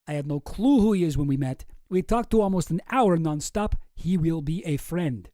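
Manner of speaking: deliberate mock-Russian accent; Boris with Russian accent. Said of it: "do it again with the right accent".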